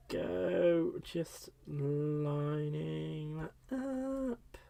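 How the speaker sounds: soft, very slow, stretched singsong; very drawn out. Real, not acted. Crafter carefully lining a piece up, soft drawn-out words. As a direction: stretch each word out softly while concentrating.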